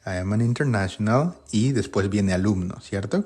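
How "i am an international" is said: international said as /inteɾnasjonal/ with a rising end; English model sentence. Shown as a demonstration. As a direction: say international clearly for the class. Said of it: "Sería".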